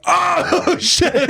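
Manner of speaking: very high, drawn-out mock cry; high-pitched imitated alarm; retold shock. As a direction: wail it in comic horror. Retold horror at popped-out eye.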